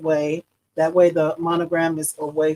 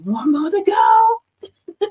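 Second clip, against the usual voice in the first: tearful; 14 semitones higher, 6 dB louder, much slower than usual. Real, not acted. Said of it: "excuse me if I get a little emotional".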